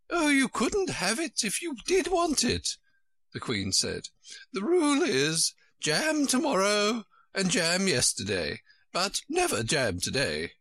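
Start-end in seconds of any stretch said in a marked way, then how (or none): 0.1-2.8 put-on high, fluttery old queen voice; a high, flustered royal voice. Queen's voice held by reader. 4.5-10.6 put-on high, fluttery old queen voice; a high, flustered royal voice. Queen's voice held by reader.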